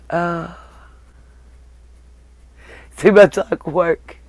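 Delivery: ordinary talk, nothing marked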